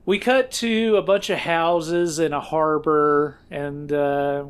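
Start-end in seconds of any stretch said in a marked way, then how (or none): none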